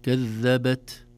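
whisper-release the ت of "كذبت"; breathy t. Shown as a demonstration. Teacher demonstrating the hams on the ت of "كذبت". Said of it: "يسمى همس التاء".

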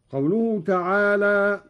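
ordinary delivery

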